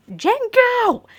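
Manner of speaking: angry brother yelling at the blushing tiger; furious yell. A narrator's reading. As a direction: bellow it across the room. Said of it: "he yelled".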